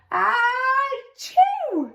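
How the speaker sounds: high, hammed-up achoo sneeze; big sneeze. Narrator reading to child, acting out the sneeze. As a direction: act out an almighty sneeze.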